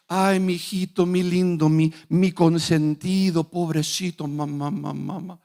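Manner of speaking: mock-pitying baby talk; mock doting parent. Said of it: "No vemos a, a Dios".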